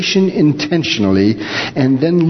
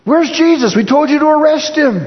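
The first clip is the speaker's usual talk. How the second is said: indignant priests; 12 semitones higher than usual. Acted. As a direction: bark it like outraged priests, quick. Priests demand of the guards.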